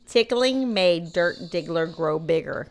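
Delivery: slow, every word overshaped; slow exaggerated mouthing. Shown as a demonstration. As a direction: mouth it slowly for lip reading. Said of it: "Okay, go".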